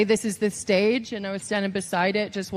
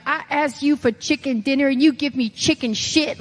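higher, put-on scolding coach; Dusty Rhodes; 4 semitones higher than usual. Trainer panning a weak promo, imitated scolding. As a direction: scold like a colourful old trainer. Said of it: "this is a Dusty impression".

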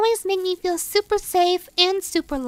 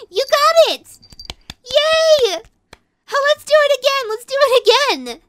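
high, loud delighted cheering; excited cheer; 6 semitones higher, 7 dB louder than usual. Acted. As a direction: cheer like a thrilled kid. Excited play with toy.

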